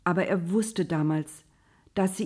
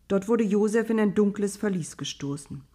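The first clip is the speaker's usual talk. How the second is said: usual speaking voice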